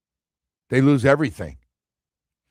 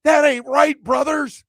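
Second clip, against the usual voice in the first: much higher, slow wrestling-promo shout of an angry coach; Dabo rant; 14 semitones higher, 5 dB louder, slower than usual. Retold or imitated. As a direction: ham up a fired-up coach yelling like a WWF promo. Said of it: "I just went a little W W F".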